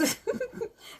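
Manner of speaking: high burst of laughter. Real, not acted.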